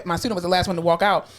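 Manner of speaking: usual speaking voice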